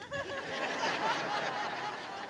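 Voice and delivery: long high cackling laugh; high cackle. Retold or imitated. Friend cackling at the pastor. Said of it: "then she laughed some more".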